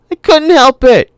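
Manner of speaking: put-on high, loud wailing girl; girl shrieking at her brother in misery; miserable shriek. A narrator's reading. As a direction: shriek it out miserably, like a scared child. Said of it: "she shrieked miserably".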